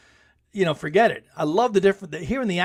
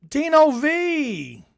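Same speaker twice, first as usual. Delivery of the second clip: usual speaking voice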